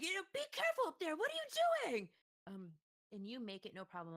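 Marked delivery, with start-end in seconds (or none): 0.0-1.2 high, loud and fast, calling up in alarm; alarmed gentleman. Acted. Townsman calling up to the adventurer on the wagon, high and alarmed. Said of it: "one of the gentlemen is like". 1.2-2.1 very high, loud cry of disbelief; alarmed yell. Acted. Gentleman shouting up at the woman on the wagon, shrill alarm. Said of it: "one of the gentlemen is like".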